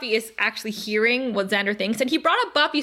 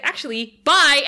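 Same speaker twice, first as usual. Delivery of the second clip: sudden loud exit line; abrupt loud bye; 2 semitones higher, 5 dB louder than usual. Retold or imitated. Retelling Xander bolting from the room, sudden loud bye. Acting Xander fleeing the library.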